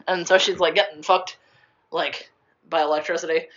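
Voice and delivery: ordinary delivery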